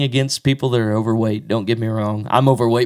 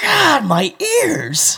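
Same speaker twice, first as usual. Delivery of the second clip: slow pained complaint; much slower than usual. Retold or imitated. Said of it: "She's like".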